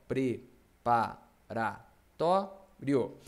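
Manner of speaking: "Preparatório" very slow, pre-pa-ra-tó-rio with gaps; syllable by syllable. Shown as a demonstration. Teacher splitting the word for the class, syllable by syllable. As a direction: chop "Preparatório" into separate, measured syllables. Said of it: "vou separar as sílabas".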